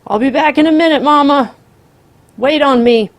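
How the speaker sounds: high, loud call from a distance; shouted. A narrator's reading. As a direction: shout it back over the shoulder.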